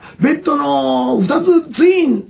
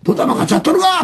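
raised-pitch Osaka threat; mock threat; 7 semitones higher than usual. Shown as a demonstration. Showing whether girls use rough slang, mock threatening. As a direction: act out a rough threat without real anger.

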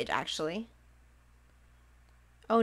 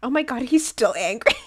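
loud exclamation; 8 dB louder than usual. Real, not acted. Villager still angry.

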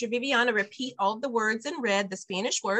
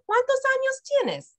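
put-on parent asking a child; 3 dB louder than usual. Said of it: "practice that with your child".

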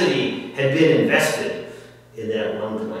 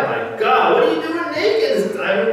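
high, loud mock disbelief; astonished exclamation; 5 dB louder than usual. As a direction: act out Krishna catching someone naked, incredulous. Krishna voiced catching Duryodhana naked.

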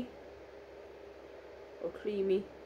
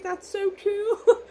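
much higher, slow, laughing through tears; imitating friend's delight; 11 semitones higher, 6 dB louder, much slower than usual. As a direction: squeal it slowly, half laughing half crying. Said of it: "She'll be like".

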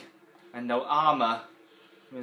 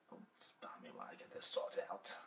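whispering; 14 dB quieter than usual. Real, not acted. Aside while game loads.

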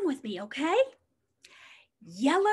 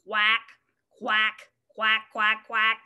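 duck quacks; 4 semitones lower than usual. Shown as a demonstration. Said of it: "What does the yellow duck say".

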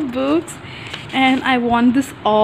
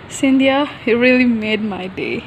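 ordinary delivery

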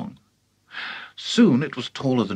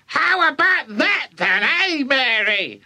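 put-on high screechy old woman; boastful high granny holler; shouting granny; 12 semitones higher, slower than usual. A narrator's reading. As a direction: crow it from the rooftop. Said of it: "Grandma shouted".

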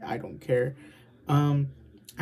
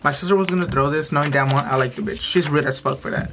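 yelling; 9 dB louder than usual. Real, not acted. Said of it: "Why am I yelling?".

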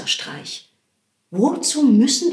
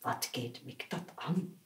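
much lower and softer grumble; muttered thought; 7 semitones lower, 16 dB quieter than usual. Acted. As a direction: grumble it under your breath like a farmer.

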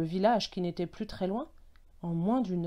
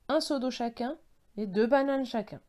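ordinary delivery, nothing marked

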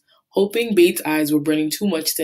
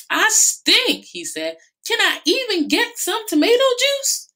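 whiny Bate. Acted. Bate whines leaving.

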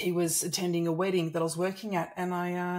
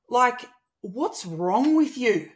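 louder, slow and accusing; fired up; 6 semitones higher, 7 dB louder, slower than usual. Retold or imitated. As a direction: confront him, pressing each word. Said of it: "I was so fired up".

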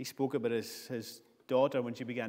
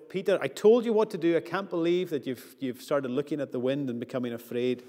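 exasperated scolding; 6 dB louder than usual. Pretend. Jesus scolding a sinking Peter, raised exasperated voice. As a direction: scold him like a fed-up teacher. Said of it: "He doesn't stand there and go".

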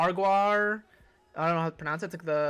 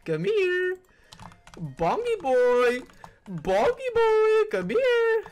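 high taunting singsong; 8 semitones higher, 5 dB louder, much slower than usual. Acted. High, loud, slow taunting calls.

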